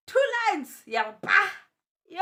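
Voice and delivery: loud, high cry at the test result; shocked shout. Real, not acted. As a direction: yell it out as the two lines appear.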